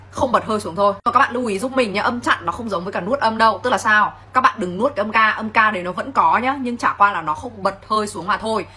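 normal talking voice

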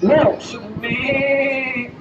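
ordinary talk

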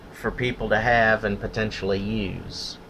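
usual speaking voice

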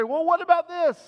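high, pointed parental question; suspicious parent. Retold or imitated. Parents grilling a child caught in a story, pointed and high. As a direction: catch the kid out with "what".

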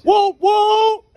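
high, loud whooping siren call; playful police siren imitation; mock siren. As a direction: whoop like a cop car to spook him.

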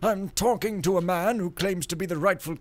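loud, high self-important priest; pompous priest note. Pretend. Gamer reading the priest's door sign, pompous voice. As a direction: announce it with lofty arrogance.

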